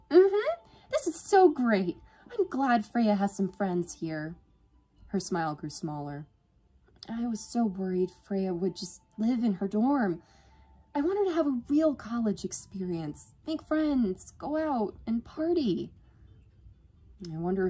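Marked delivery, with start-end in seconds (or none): 0.1-4.4 high, loud gush, easing down; overenthusiastic. A narrator's reading. Yvonne glad Freya has friends here, gushing. 7.1-15.9 high-pitched hopeful sister; earnest, worried Yvonne. A narrator's reading. Yvonne worried Freya won't leave her dorm, earnest.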